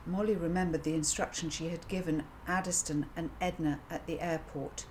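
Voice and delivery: plain reading voice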